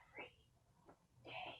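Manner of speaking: soft unvoiced whisper; just breath, no voice. Shown as a demonstration. Teacher leading kids in a whispered chant. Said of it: "Whisper it one more time".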